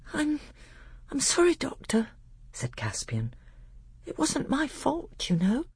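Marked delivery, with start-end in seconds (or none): 0.1-2.1 put-on high, hesitant young voice; sheepish young prince, pitched way up; apologetic boy. Boy apologizes, stung by the reproach. 4.0-5.6 put-on young prince meekly defending himself; apologetic boy. Boy excuses himself to his tutor.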